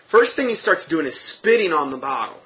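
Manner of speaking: ordinary delivery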